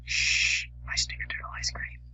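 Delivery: secretive whisper guarding his treat. Acted. Friend hushing his buddy over his own ice cream, whispering. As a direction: breathe it out, no voice, keep it hidden. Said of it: "Why do we need to whisper?".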